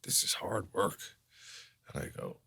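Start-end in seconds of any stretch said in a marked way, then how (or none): 0.0-1.0 soft, weary veteran actor impersonation. Retold or imitated. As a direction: trail into a hushed old-timer's complaint. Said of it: "And he goes".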